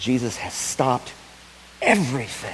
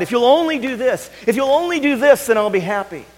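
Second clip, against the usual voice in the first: high, loud, pushy pleading; pleading bargain; 9 dB louder than usual. Acted. People bargaining with God, high insistent pleading. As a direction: beg God to strike a deal.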